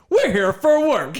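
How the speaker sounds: drawn-out mock announcement; Peyton impression. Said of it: "He goes".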